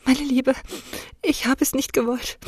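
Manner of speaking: a mother's tearful plea, only lightly played; sobbing. Mother begging her daughter's forgiveness, a touch of sobbing. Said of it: "schluchzte meine Mutter".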